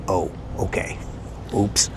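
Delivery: a caught-out delivery man backing off quietly; sheepish oops. Retold or imitated. Caught at wrong door.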